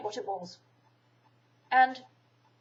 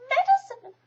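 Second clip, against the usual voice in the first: dismayed Piglet; 9 dB louder than usual. A narrator's reading.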